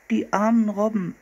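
put-on high girlish lament; pitying girl. A narrator's reading. Sister learning the jackets are sealskin, high pitying voice. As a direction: pity them in a high girl's voice. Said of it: "sagte Anne".

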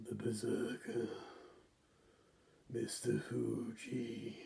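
slow, hushed menace sinking into a whisper; drawn-out, whispered threat with long pauses; The Undertaker. Acted. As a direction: intone it slowly, fading to a ghostly whisper. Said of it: "The Undertaker didn't take things very well".

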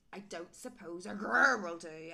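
loud bear voice with a big growl; booming storybook bear; grizzly bear. A narrator's reading. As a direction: ask like a great growly bear. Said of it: "asked the great big grizzly bear".

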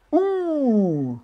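a high, soft "ouh" at a near miss; surprised ooh. Real, not acted. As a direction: react to the ball just missing, high and soft. Reacts to a near-miss putt.

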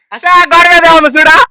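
high, loud urging to look; loud, high-pitched nagging command; insistent shout. Real, not acted. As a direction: raise your voice sharply to make them look. Urging companion to look at wall.

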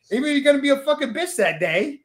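high-pitched mock Jersey accent; badly faked Jersey accent, pushed way up. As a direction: tease him with a terrible Jersey accent. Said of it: "I am terrible at accents".

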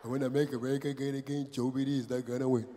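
low, soft, run-together mumbling; Donald Trump. Retold or imitated. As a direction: garble the slogan quietly under your breath. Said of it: "We don't hear what Donald Trump says".

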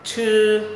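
"Chư" held long on a high level tone, tongue curled up. Shown as a demonstration. Teacher models "Chư" for learners, tongue curled. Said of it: "âm uốn lưỡi".

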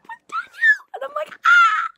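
high, loud fangirl shriek; excited scream. Real, not acted. Character mentions his relationship, excited shriek. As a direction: shriek with joy.

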